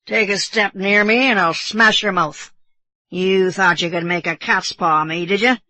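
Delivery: lightly voiced belligerent drunk sailor; drunken bosun. A narrator's reading. Drunk bosun warning Kiawe off, mildly blustering threat. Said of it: "how drunken it appeared".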